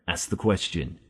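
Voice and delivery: ordinary delivery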